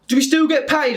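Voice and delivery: high imitated shout from the crowd; calling out. An extra asking the crew, high called-out question. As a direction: pipe up from the back of the room.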